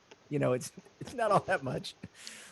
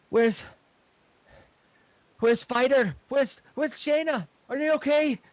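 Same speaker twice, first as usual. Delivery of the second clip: loud, high, panicked stammer; frantic halfling; 6 semitones higher, 8 dB louder than usual. Acted. Revived halfling asking after family, frantic. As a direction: blurt urgent questions, searching for the others.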